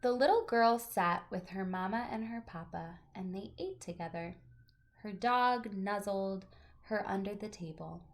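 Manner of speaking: gentle, expressive picture-book reading; reading to children. Acted. Teacher reading a picture book aloud to her class, storytelling voice.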